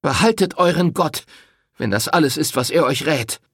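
high, loud, contemptuous; bitter rejection. Acted. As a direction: spit out the renunciation. Rosetta renounces his God. (verächtlich laut)

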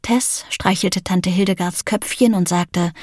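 plain reading voice

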